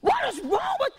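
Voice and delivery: high-pitched mock yell; retold yelling. Wife imitates her husband yelling while watching something, high mock shout. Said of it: "my husband started yelling".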